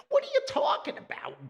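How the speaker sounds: father yelling back in disbelief; loud, high outraged question; indignant shout. Retold or imitated. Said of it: "The old man's like".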